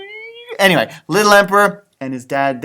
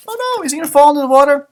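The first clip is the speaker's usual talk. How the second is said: mock worry; 9 semitones higher, 5 dB louder, much faster than usual. Worried aside for children.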